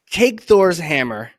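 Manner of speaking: slow, loud, grand declaration; dramatic announcement. Pretend. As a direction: hand it over like a sacred relic. Dramatic hammer handover.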